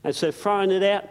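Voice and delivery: ordinary talk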